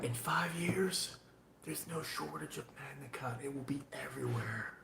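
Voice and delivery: hushed whisper, leaning in to the mic. Real, not acted. Knife maker confiding a prediction to his viewers in a whisper. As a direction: let them in on a secret.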